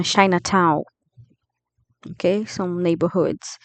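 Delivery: ordinary talk, nothing marked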